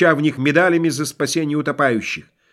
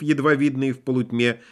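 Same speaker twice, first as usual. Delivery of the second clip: plain reading voice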